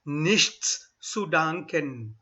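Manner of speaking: very slow Nichts zu danken, every sound; very slow model. Shown as a demonstration. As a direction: pronounce every letter for the class. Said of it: "Try and pronounce every alphabet".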